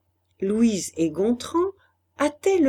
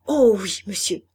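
eager, louder-starting yes; 4 dB louder than usual. A narrator's reading. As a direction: jump at the offer. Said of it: "Elle répondit avec élan". (réponse pleine d'élan)

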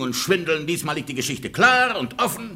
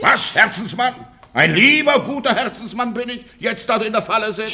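higher, louder, laughing mockery; higher mocking laugh-through; mocking echo; 5 semitones higher, 4 dB louder than usual. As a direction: throw her sweet words back at her. Husband mocks her pleading.

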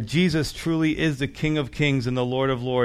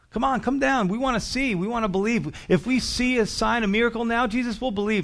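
high-pitched mock crowd taunt; hammed-up jeering for a sign, pitched high; mocking demand; 8 semitones higher than usual. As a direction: goad him in a raised, sing-out voice.

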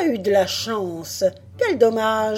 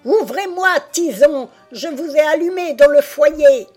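high, loud shriek of fury; bawling furious witch; 7 semitones higher, 8 dB louder than usual. A narrator's reading. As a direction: bawl it, about to explode. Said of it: "brailla la sorcière sur le point d'exploser". (sorcière qui braille de fureur)

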